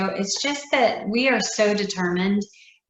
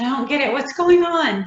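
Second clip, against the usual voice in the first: bewildered asking; 6 semitones higher, 7 dB louder than usual. Retold or imitated. Confused while others cry.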